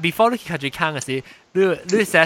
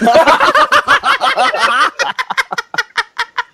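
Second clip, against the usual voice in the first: loud, high peal of laughter; uproarious laughter; 7 dB louder than usual. Real, not acted. Teasing friends about what to order, uproarious laughter. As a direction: burst out laughing loudly and keep it going. Cracks up during teasing banter.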